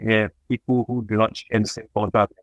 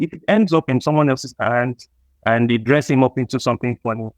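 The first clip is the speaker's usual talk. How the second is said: usual speaking voice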